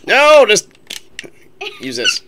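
high, loud urgent yell; urgent shout. Real, not acted. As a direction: shout it out in a panic. Urgent instruction in a fight.

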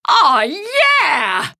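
loud delighted cheer. Acted. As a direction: burst out with joy at the baby. Father delighted with baby.

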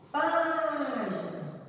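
Página with PÁ high and held; stress on first syllable. Shown as a demonstration. Teacher showing the tonic syllable of Página, PÁ drawn out. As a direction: stretch the "Pá" of "Página". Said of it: "o Pá".

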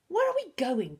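nervous. A narrator's reading. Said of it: "Bertolt asked nervously".

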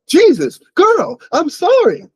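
high, loud anguished cry of sorry; high, pained apology to the dog; dismayed apology. A narrator's reading. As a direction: blurt it out in dismay, slow on the apology. Reading character's dismayed apology.

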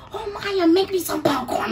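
mom asking. Retold or imitated. Imitating mom ordering popcorn from bed, high mock plea. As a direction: ask like a lazy mom in bed. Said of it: "she could be like".

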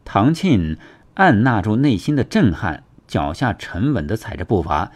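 usual speaking voice

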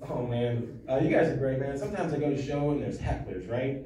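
ordinary talk

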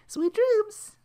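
very high, sing-song goodnight; sweet landlady. Retold or imitated. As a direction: chirp it like a cheery old hostess. Landlady cheerfully saying goodnight.

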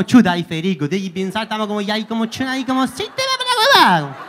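put-on Chilean accent, rising and falling sing-song; imitación de chileno. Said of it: "está como". (acento chileno exagerado, burlón)

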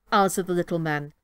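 plain reading voice

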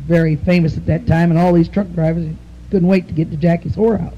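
normal talking voice

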